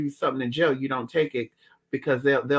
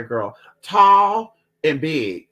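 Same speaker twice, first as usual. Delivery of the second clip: ordinary talk, nothing marked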